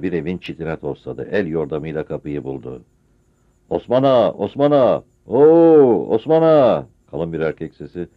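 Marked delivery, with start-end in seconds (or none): 3.7-6.9 loud call at the door. Acted. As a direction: holler the name into the dark. Calling at a door at night.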